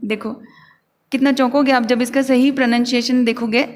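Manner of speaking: usual speaking voice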